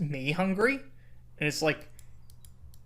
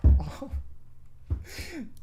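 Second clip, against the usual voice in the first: breathy high laughter; real high laugh; 12 semitones higher than usual. Real, not acted. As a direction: laugh it out in disbelief. Laughing after outburst.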